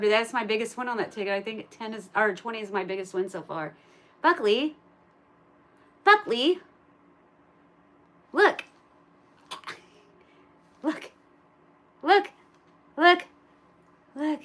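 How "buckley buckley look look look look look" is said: higher, louder pet call; calling the dog. Real, not acted. Owner calling Buckley to look at the camera, high pet call. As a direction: sing his name to get the dog's attention.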